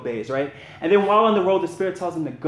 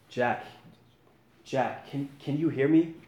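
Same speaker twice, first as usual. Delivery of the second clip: a hushed divine voice, unhurried; voice of God; 5 dB quieter than usual. A narrator's reading. God calls down to Jack on the cliff, quiet and calm.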